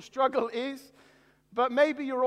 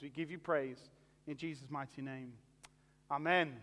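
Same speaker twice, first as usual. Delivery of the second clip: prayer; 3 semitones lower than usual. Acted. Pastor praying over viewers, hushed and reverent. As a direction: pray humbly for everyone watching.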